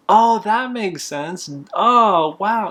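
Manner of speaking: high, slow, exaggerated dawning; mock realization. The embarrassed son playing along with the customer, high and drawn out. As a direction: drag it out, pretending it all clicks.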